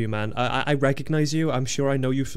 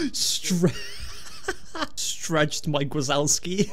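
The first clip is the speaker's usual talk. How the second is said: laughing; 8 semitones higher, much slower than usual. Real, not acted. Laughing at stretched character.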